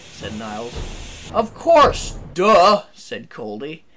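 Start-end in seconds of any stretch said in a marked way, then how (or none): 1.3-2.8 loud, high, mocking "obviously"; scornful retort. A narrator's reading. Coldy answering Niles's guess, loudly scornful. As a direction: blurt it out with scorn.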